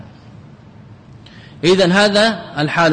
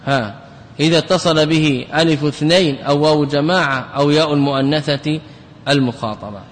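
normal talking voice

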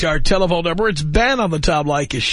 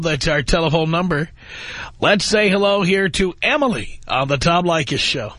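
ordinary talk

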